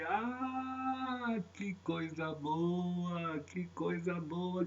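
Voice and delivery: soft, drawn-out sigh of wonder; tender delight. Real, not acted. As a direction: savour it slowly and softly. Savours being called by name.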